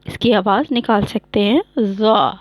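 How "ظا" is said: "غا" as heavy Arabic Zaa, tongue touching palate and front; emphatic z with a rounded long vowel in "غا"; demonstrated letter Zaa. Shown as a demonstration. Teacher showing tongue on the palate, heavy emphatic "غا". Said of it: "تالو کے ساتھ آگے سامنے سے زبان touch".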